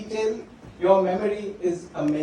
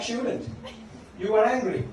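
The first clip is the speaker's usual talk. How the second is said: normal talking voice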